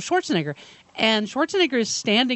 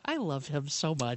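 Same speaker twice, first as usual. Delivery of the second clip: quiet; 4 semitones lower, 9 dB quieter than usual. Real, not acted. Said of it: "that moment I just went".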